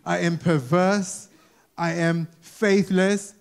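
perverse and faithless drawn out slowly, each word weighed; slow mock confession. Shown as a demonstration. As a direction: deliver the bad traits slowly as if reading them off a CV. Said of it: "who puts in their C V".

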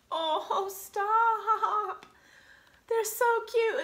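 high, loud cooing over something cute; delighted gushing. Real, not acted. Woman finds kitty socks, delighted gushing. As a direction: squeal it, charmed to bits.

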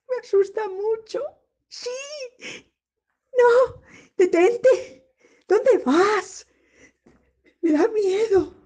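high, loud cries with a nervous laugh; panicked fear. Shown as a demonstration. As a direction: shriek at it to stop. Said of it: "algo que me asusta". (pánico agudo y fuerte, con risa nerviosa)

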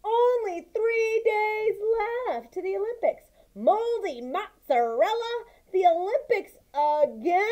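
exasperated. Acted. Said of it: "I snorted".

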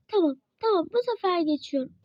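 usual speaking voice

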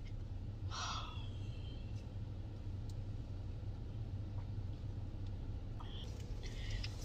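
a long breathy exhale to collect yourself; deflated sigh after venting; long weary sigh. Real, not acted. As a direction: let out a drawn-out, shaky sigh. Calming herself after the rant.

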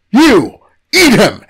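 commanding shout. Acted. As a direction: order the feast. God commands.

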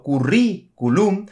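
currículum drawn out with the stress hitting rí; teacher stretches currículum to show its accent; stressed on the first syllable. Shown as a demonstration. Slow currículum with the stress clearly on rí. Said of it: "porque son esdrújulas".

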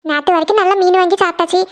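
ordinary delivery

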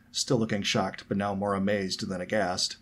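ordinary delivery

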